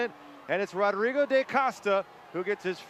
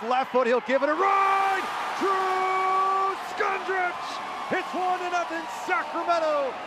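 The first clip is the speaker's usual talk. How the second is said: building from louder to a high, stretched yell; long goal yell; 10 semitones higher, 3 dB louder than usual. Real, not acted.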